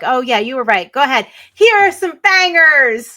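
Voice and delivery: high, loud, drawn-out whoop of approval; hammed-up excited client handing over goods; mock gleeful go-ahead. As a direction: build from breezy agreement to a sung-out holler. Acting out client giving in gleefully.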